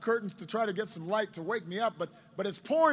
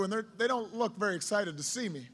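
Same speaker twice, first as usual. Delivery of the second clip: ordinary delivery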